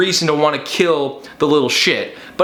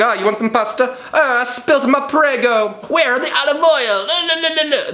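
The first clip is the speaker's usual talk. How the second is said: high, hammed-up Italian accent, laughing at the end; high mock Italian lady voice; 8 semitones higher than usual. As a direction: play a flustered Italian lady, pitched high. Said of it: "It's like".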